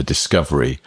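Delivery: ordinary talk, nothing marked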